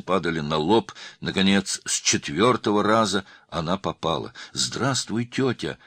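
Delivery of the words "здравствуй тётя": high, mild cry of greeting. A narrator's reading. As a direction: call out to an aunt, pitch raised. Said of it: "закричала Ирада".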